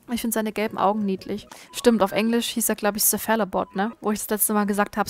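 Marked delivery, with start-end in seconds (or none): none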